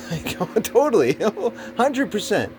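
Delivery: laughing. Real, not acted. Laughing agreement.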